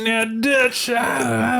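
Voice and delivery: high, raspy cowboy imitation; Arthur Morgan voice. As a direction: scratch your voice like Arthur. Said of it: "a little scratch in his voice".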